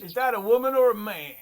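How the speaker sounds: mock exaggerated question. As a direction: repeat the joke, camping it up for a laugh. Repeats the joke in a put-on voice.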